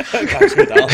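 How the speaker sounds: high giggly repeat through laughter; laughing at the friend's joke. Real, not acted. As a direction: crack up while echoing the line.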